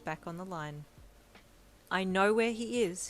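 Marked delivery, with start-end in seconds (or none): 1.9-3.1 brightly. A narrator's reading. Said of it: "he said brightly".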